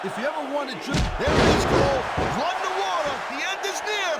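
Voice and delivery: high-pitched, excited ringside commentary; wrestling commentator getting worked up. Acted. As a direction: build to a high, thrilled call. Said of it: "How can you sound so excited".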